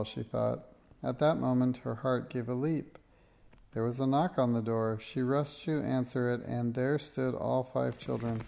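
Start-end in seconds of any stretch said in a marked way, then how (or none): none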